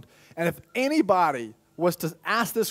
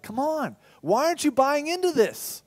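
much higher, pushing frustration; exasperated urging; 11 semitones higher than usual. Acted. The soul urging the heart, exasperated and high. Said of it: "he's like".

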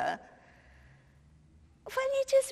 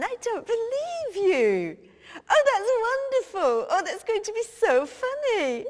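delighted disbelief; 8 semitones higher than usual. Acted. [name] thrilled on the phone about [name] in drag, high gleeful delight. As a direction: squeal with delight at the gossip. Hears [name] is [name].

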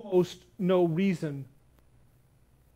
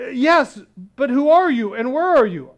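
loud, high questioning call; dangling man shouting to an unseen voice; urgent shout; 6 semitones higher, 9 dB louder than usual. Acted. Jack shouts upward.

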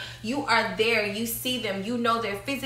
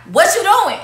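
loud, higher mocking "What you doing?"; mock lazy pickup line; 7 semitones higher, 8 dB louder than usual. Woman mocking lazy men's messages, loud and higher. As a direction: toss it off like a careless DM. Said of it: "was like".

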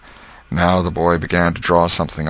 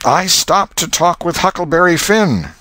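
put-on high, louder boy's reckless confession; bold schoolboy; 13 semitones higher, 5 dB louder than usual. Tom confessing to the master, high and bold. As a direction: blurt it out like a cheeky boy.

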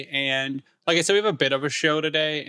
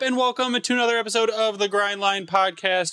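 high-pitched opening greeting settling toward usual pitch; podcast intro; 6 semitones higher than usual. Acted. Host welcoming listeners to a new episode, show-opener voice.

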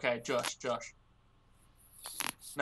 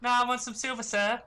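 high, insistent beggar voice; picky beggar; 8 semitones higher, 3 dB louder than usual. Acted. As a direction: play a beggar who won't settle. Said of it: "choosiest beggar I've ever seen".

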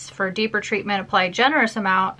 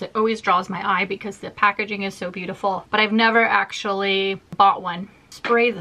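usual speaking voice

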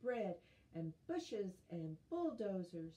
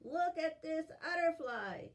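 high, loud and excited; bright excited look; 8 semitones higher, 5 dB louder than usual. Acted. Excited showing a picture.

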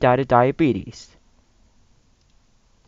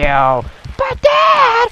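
put-on high squeaky child plea; high whiny son; 4 dB louder than usual. A son whines back at his yelling dad. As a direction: whine it like a little boy.

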